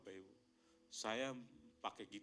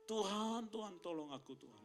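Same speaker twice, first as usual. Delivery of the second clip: crying out to God; 9 semitones higher than usual. Retold or imitated. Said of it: "saya teriak sama Tuhan".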